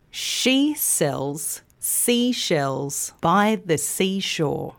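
slow, measured tongue twister, joined up. Shown as a demonstration. Teacher repeats the twister for learners, slow and measured. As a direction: keep it slow with shorter pauses. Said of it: "start by saying it slowly".

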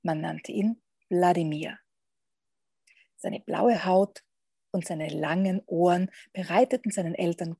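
measured read-aloud with long pauses; lively picture-book narrator, pausing between phrases; story narration. A narrator's reading. Podcast guest reads a vampire picture book to listeners, storytelling.

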